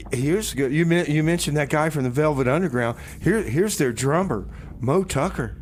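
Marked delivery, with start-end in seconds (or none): none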